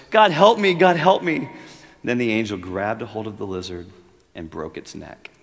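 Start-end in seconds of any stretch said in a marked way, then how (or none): 0.1-1.5 much higher and louder desperate cry; anguished plea. Acted. Ghost cries out as the lizard is killed, anguished. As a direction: cry out to God in terror.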